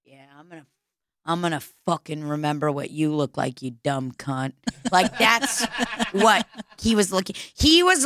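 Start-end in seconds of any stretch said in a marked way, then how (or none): 0.1-4.5 low, much softer creepy threat; menacing stranger. Pretend. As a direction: voice the stranger's silent threat, quiet and lower. Imagined threat from staring man.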